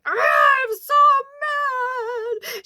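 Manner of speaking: acted high, wailing angry cry; crying outburst. Grieving friend breaks down in front of her friends, acted crying fury. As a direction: imitate a loud, drawn-out sobbing outburst. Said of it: "she's crying so hard".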